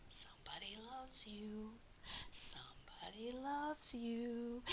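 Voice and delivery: slow faint whisper, almost no voice. A narrator's reading. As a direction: breathe it like a ghostly secret. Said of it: "the dust cloth was whispering".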